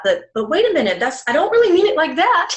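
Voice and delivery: imitated indignant backtracking; defensive backpedalling. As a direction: act out someone hastily taking it back. Acted defensive backpedalling reaction.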